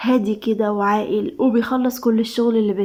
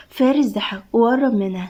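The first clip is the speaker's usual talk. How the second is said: ordinary delivery, nothing marked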